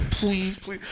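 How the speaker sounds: quiet imitated begging; soft pleading. Man imitating his girlfriend begging him, softly. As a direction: drop soft for a little mock plea. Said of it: "She goes".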